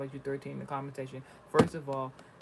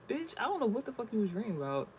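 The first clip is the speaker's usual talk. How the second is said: pitched-up exasperated comeback; incredulous retort; 8 semitones higher than usual. Retold or imitated. Daughter firing back at her mother, exasperated and pitched up. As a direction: throw it back at her, disbelieving. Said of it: "I'm like".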